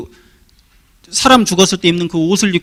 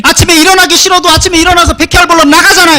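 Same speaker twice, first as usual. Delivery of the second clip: fervent preaching; 4 semitones higher, 4 dB louder than usual. Real, not acted. Pastor urging congregation to evangelize.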